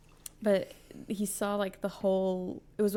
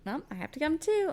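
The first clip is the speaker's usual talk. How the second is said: quoting Claire; 8 semitones higher, faster than usual. Retold or imitated. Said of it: "Claire's like".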